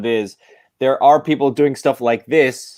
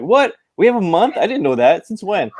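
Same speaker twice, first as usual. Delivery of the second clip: higher, hammed-up disbelief; mock surprise; 7 semitones higher than usual. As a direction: imitate clueless people, shocked. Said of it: "I always hear from people on Twitter".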